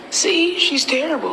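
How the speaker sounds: high-pitched mimicry of a critic; put-on high voice of an accuser; mock judgmental remark. Mimics people judging her.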